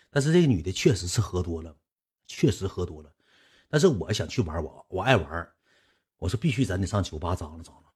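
ordinary talk

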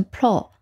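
pro said alone with a short open O; syllable sounded out. Shown as a demonstration. Teacher reading the first syllable for learners, pro with short O. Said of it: "它读成pro".

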